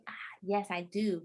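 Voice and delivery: soft unvoiced confession; sheepish whisper; whispered admission. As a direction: whisper it like a guilty secret. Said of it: "I was like".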